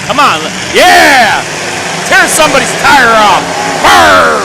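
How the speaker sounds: excited cheer. Real, not acted. Cheering on a derby crash.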